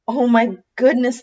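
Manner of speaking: high, bright gasp of surprise; a fox amazed to find Santa asleep; surprised. Acted.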